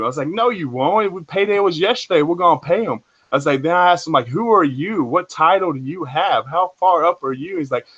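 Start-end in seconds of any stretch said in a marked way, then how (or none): 0.3-3.0 higher-pitched insistent retort; insistent quoted comeback, pitched up; retold firm objection. Retold argument over paying people. 4.3-7.6 higher-pitched challenging questions; quoted demand, pitched up; retold challenge. Retold challenge to the man's rank.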